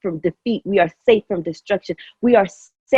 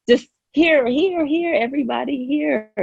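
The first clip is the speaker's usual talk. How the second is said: excited; 7 semitones higher than usual. Real, not acted. Gushing with overflowing joy.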